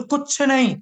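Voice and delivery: usual speaking voice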